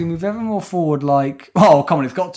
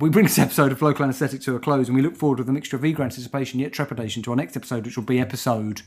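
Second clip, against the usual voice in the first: ordinary talk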